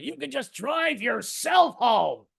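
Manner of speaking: slow high shout; man yelling at his wife from the roadside. Retold or imitated. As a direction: bellow it out the car door. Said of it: "I yelled at my wife".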